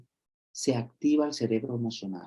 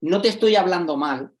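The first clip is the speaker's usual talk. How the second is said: defensive retort; 6 semitones higher, 6 dB louder than usual. Retold or imitated. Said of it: "Si yo le digo".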